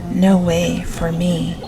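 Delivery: usual speaking voice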